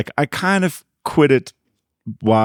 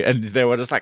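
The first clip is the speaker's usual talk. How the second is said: ordinary talk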